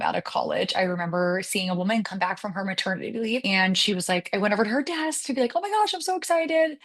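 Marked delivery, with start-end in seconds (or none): 5.6-6.8 bright, much higher squeal of delight; retold excitement. Coworker back from leave, greeted with high excited squeal. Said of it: "super excited".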